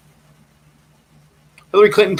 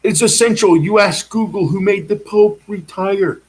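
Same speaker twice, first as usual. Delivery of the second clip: higher, slow, proclaimed demand; a slow, higher, insistent proclamation at a stranger; accosting shout; 6 semitones higher than usual. Retold or imitated. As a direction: confront the cop with a slow, raised declaration. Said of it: "I accosted the first metal detector cop with".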